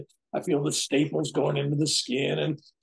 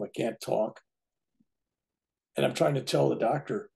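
normal talking voice

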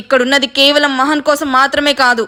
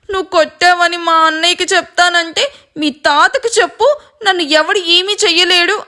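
higher, smug quoting of his boast; arrogant; 6 semitones higher than usual. A narrator's reading. Sister mimicking the brother's boast, arrogant. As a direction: toss it off with cocky pride. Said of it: "అని పొగరుగా అంటున్నాడు".

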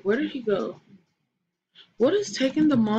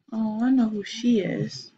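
ordinary delivery

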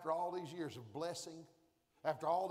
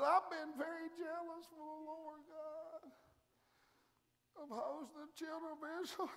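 whiny Elijah; 8 semitones higher, 6 dB quieter than usual. Acted. Elijah voiced complaining quietly.

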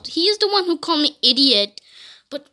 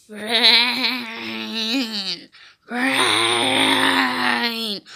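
long, low zombie moan; play zombie voice; 8 semitones lower than usual. Pretend. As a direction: stretch the word into a zombie groan. Zombie groans for brains.

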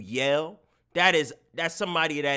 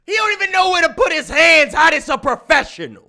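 very high, loud outburst; incredulous yelling; 13 semitones higher, 11 dB louder than usual. Real, not acted. Streamer yelling about the so-called professional climber, incredulous. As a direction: yell it in high-pitched disbelief.

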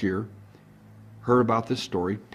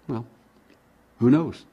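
ordinary delivery

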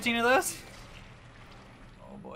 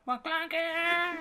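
high shout; 11 semitones higher than usual. Real, not acted. Shouting the character name.

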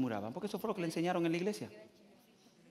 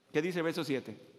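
usual speaking voice

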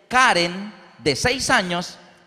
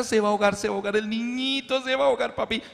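put-on high, frightened little girl; four-year-old daughter panicking at the movie; scared child crying out; 3 semitones higher, faster than usual. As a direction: plead with papi that the boy will drown. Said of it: "enterraba la cabeza".

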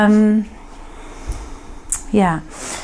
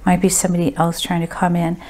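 ordinary talk, nothing marked

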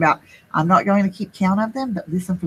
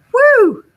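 hot whew; 8 dB louder than usual. A narrator's reading. Overheated snowman exhales.

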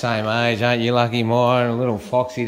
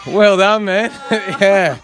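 loud, high congratulations through laughter; laughing praise; 7 semitones higher, 5 dB louder, slower than usual. Real, not acted. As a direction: cheer them on while cracking up.

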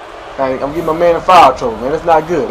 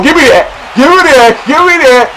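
higher, louder triumphant chant; hyped celebration; 9 semitones higher, 6 dB louder than usual. Real, not acted. Celebrating own bucket to viewers, hyped. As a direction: demand it like a showman.

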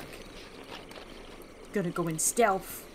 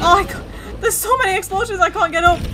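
fast, high, loud panicked shouting; half-laughing panic at high pitch; frantic; 10 semitones higher, 7 dB louder, faster than usual. Real, not acted. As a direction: yell in a fluster amid explosions. Overwhelmed by explosions in gunfight.

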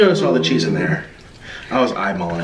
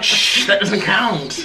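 slow, giggling shush; laughing denial; 5 semitones higher, slower than usual. Retold or imitated. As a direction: brush it off while laughing. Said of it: "I'm like".

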